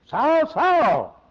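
loud high call. Acted. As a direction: call his name with longing. Christ calling Saul again.

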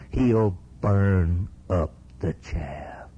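"burn up the chaff" drawn out word by word; slow emphatic warning. Real, not acted. Preacher warns congregation of coming judgment, slow and emphatic. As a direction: spell out the judgment very slowly. Preacher drives home the verse.

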